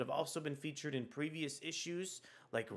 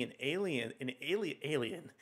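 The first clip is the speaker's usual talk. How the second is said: stumbling over a word while reading aloud; tongue-tied. Real, not acted. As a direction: trip on the word "alien" and retry it. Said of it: "see if I can talk today".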